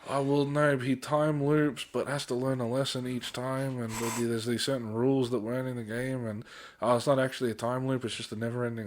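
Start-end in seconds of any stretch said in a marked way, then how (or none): none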